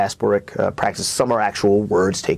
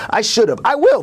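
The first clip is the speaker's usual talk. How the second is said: high and louder, straining upward; rising cry; 13 semitones higher, 6 dB louder than usual. Acted. Poet reaching the poem's climax, high rising cry. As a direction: let the lines climb into a cry.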